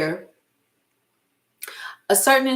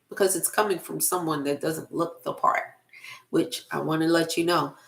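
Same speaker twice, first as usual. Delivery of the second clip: ordinary talk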